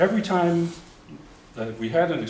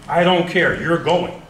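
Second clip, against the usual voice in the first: loud insisting parents, higher; 8 semitones higher, 14 dB louder, slower than usual. Retold or imitated. Parents sending their son abroad, a loud firm insistence. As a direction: lay down the law loud, I don't care. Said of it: "mother and father probably like to say".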